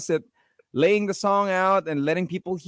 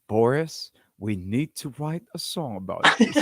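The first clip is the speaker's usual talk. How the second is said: mock epic; 6 semitones lower than usual. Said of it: "It sounded so epic".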